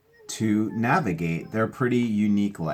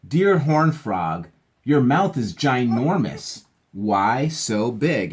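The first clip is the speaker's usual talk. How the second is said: put-on reading to children; 4 semitones higher, 7 dB louder, much slower than usual. Reads letter to frog.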